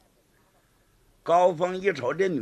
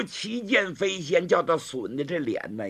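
plain reading voice

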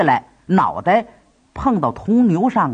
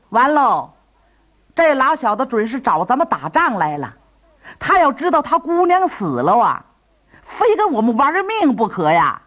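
alarmed; 7 semitones higher than usual. Acted. Tang Tieniu alarmed at enemy arrival.

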